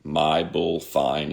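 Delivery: "my bull fine" slowly, each word distinct; slow, separated words. Retold or imitated. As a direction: draw out "my bull fine" as three plain words. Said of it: "say these three words".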